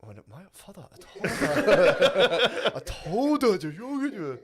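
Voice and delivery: botched Korean accent breaking into high, loud drawn-out words; slow, hammed-up Korean accent, laughing; mock Korean accent. Shown as a demonstration. Comic attempts the same sentence, mock Korean accent. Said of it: "All right, now do Korean".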